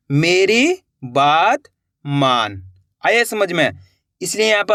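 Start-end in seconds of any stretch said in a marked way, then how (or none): 0.1-2.6 slow, each word separated by a pause; slow word-by-word reading. Shown as a demonstration. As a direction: read it out word by word for learners. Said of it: "वाक्य का उच्चारण कैसे हो रहा". (धीरे-धीरे, शब्द-शब्द अलग)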